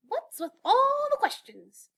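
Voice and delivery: high, loud cartoon penguin. A narrator's reading. Penguin grumbling at the elf, high and loud. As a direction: squawk it out as an annoyed penguin.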